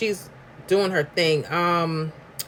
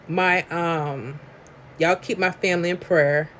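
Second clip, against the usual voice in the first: ordinary delivery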